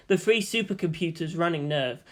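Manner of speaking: usual speaking voice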